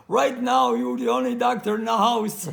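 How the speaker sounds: loud, laughing punchline; laughing quote. Retold or imitated. Retells customer's joking reply.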